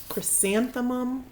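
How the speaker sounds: usual speaking voice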